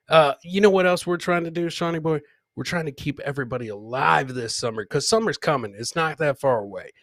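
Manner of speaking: usual speaking voice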